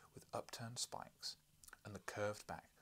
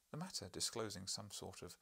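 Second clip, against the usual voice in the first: usual speaking voice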